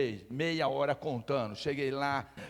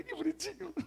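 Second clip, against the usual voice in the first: mock cute voice; 14 semitones higher, 6 dB quieter than usual. Mocking the sweet prayer.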